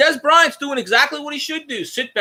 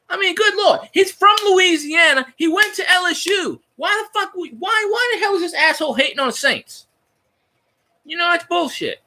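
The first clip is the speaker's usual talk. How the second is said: higher, heated outrage; heated fan rant, voice pitched up; indignant rant; 7 semitones higher than usual. Real, not acted. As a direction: vent your disbelief at him. Outraged fan ranting at pundit.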